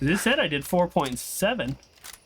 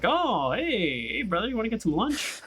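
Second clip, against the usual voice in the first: mock cheerful self; 9 semitones higher than usual. Retelling own words to brother.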